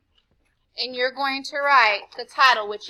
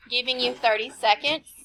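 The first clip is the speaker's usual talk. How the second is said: normal talking voice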